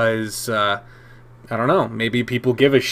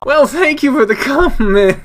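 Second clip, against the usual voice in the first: laughing thanks; 11 semitones higher than usual. Real, not acted. Recovering from laughing, thanks commenter.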